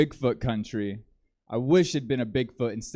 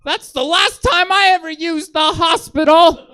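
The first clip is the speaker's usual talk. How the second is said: much higher and louder, squeaky mock voice; high-pitched Angel; 10 dB louder than usual. Angel after losing his appendix, squeaky high voice. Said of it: "his voice is more high-pitched now".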